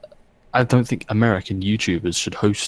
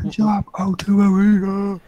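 concert hype shout; 9 semitones higher than usual. Pretend. Mimics concert greeting.

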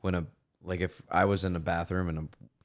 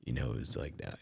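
much lower and softer, a deep rumble; 8 semitones lower, 11 dB quieter than usual. Retold or imitated. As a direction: drop way down into a deep man's voice. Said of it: "his voice when he was a dude was deep".

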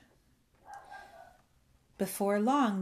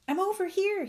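high-pitched, louder yell back; 12 semitones higher, 5 dB louder than usual. A narrator's reading. Bullfrog answering his rescuers, yelling. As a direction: yell across the storm, pitched way up. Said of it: "he yelled".

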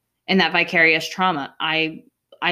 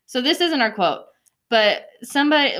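usual speaking voice

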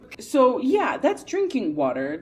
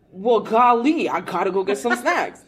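loud mock-folksy exclamation; hammed-up eager kid; 3 semitones higher, 7 dB louder than usual. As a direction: play the eager little sister. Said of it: "I was like".

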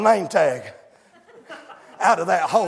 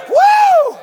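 high loud whoop; preacher whoop; 13 dB louder than usual. Real, not acted. Preacher whooping at the congregation, high and loud. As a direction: let out an ecstatic woo.

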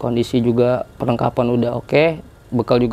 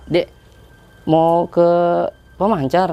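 broker's call; 5 semitones higher than usual. Retold or imitated. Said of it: "kata dia".

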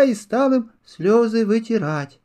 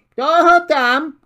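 high, louder call from inside the hollow; squirrel calling out; 9 semitones higher, 6 dB louder than usual. Acted. Squirrel calls from her hollow to the knocking hare, loud. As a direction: shout down to whoever's knocking. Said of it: "крикнула белка".

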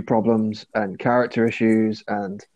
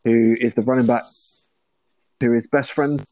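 choppy, cutting-out connection; glitching audio. Real, not acted. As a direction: voice dropping in and out over a bad line. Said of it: "You're breaking up, [name]".

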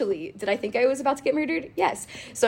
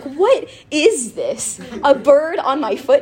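slow, drawn-out amazed question, louder; surprised; 5 semitones higher, 4 dB louder, slower than usual. Retold or imitated. Retelling the bird landing on her foot, amazed. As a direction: stretch it out in happy disbelief. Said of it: "screamed and started laughing".

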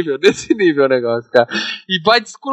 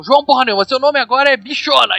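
high mock yell at a recruit; hammed-up drill sergeant insult; retelling the sergeant berating a recruit, mock yell; 7 semitones higher than usual. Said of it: "O cara fala".

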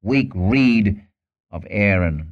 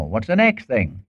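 ordinary talk

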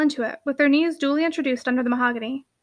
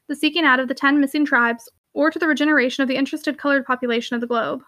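ordinary delivery

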